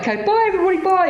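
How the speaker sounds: very high, breezy farewell; high mock-sweet bye-byes; put-on cheery goodbye. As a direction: imitate a fake-casual exit. Acting her hasty staged goodbye.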